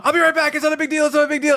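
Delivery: high, loud, flustered reassurance; flustered panic. Retold or imitated. Telling his mom in panic while handcuffed. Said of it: "And I flipped out".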